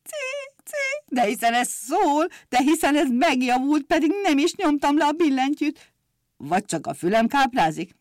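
a mild happy cry of surprise; joyful exclaim. Acted. The old organist, overjoyed, hears the missing note. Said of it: "örvendezve kiabált".

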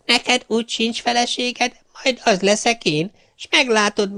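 a gleeful witch luring him to hell. Acted. Witch offers to be his wife. (magas hangú, gúnyos boszorkány)

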